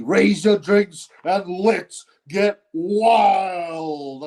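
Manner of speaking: very slow, drawn-out ring announcer shout; MC catchphrase imitation. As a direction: stretch every word like a hyped-up MC. Mimicking MC's catchphrase.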